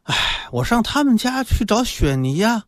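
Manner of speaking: high, plaintive weak mother; listless. A narrator's reading. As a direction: sigh into a feeble acted complaint. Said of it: "感觉是有气无力的".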